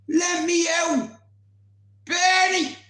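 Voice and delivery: high, drawn-out desperate yell; retold screaming. Locked-in man calling for Bernie, screaming. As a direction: holler to be let out. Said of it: "I'm screaming".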